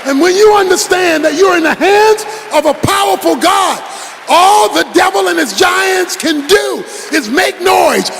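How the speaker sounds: forceful preaching. Acted. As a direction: preach it hard to the congregation.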